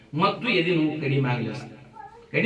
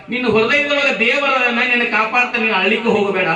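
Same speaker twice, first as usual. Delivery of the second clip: raised, high preacher's voice for the mother; impassioned reassurance; 8 semitones higher, 8 dB louder than usual. Acted. Mother tells her child God is in his heart, impassioned. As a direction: urge it loud and high.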